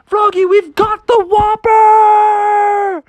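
high, loud victory shout, slowing at the end; triumphant dad. Acted. Dad to Froggy landing the fish, high triumphant shout. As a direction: crow over the whopper. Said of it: "And Froggy's dad was like".

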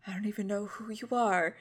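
quiet, brooding dramatic refusal; dramatic moment. Retold or imitated. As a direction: play it like a moody teen drama beat. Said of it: "has this dramatic moment".

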